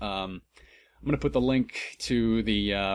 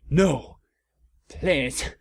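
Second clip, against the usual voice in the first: pleading no; mild mock begging; 3 semitones higher than usual. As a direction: plead with him. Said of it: "I begged".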